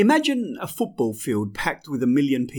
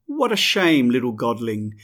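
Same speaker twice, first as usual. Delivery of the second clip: higher, smiling taunt; smiling mockery; 6 semitones higher, 4 dB louder than usual. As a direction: gloat with a smile. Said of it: "he smiles".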